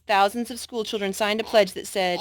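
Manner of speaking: usual speaking voice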